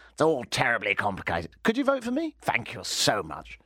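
lightly put-on posh English accent as Ben Carson; a hint of a plummy English politician begging for votes; light mock English accent; ridiculously English. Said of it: "I went ridiculously English".

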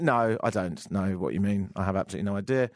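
patronising deadpan reply; condescending tone. Retold or imitated. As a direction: talk down to her, dry and unimpressed. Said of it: "a somewhat patronising, condescending tone".

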